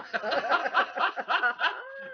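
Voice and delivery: a long, high burst of laughter; high amused laugh. Real, not acted. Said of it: "And I was laughing".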